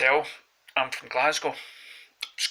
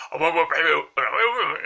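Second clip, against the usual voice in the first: loud, higher garbled mock-Scottish babble; unintelligible Scottish accent; 3 semitones higher, 4 dB louder than usual. Mimicking the guy before him, unintelligible Scottish accent. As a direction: imitate an incomprehensibly thick Scottish accent. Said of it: "one of those accents where you couldn't understand".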